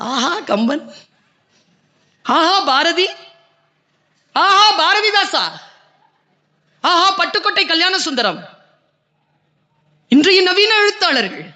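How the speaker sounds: orator's crescendo of admiration; rapturous praise. Acted. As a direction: hail each poet with mounting awe. Exalting the poets.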